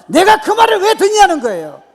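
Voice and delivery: high, roaring indignant retort; defiant shout. Acted. David rejecting talk of his failure, defiant shout. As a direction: hurl the question back at full volume. David refusing discouraging words, repeated forcefully.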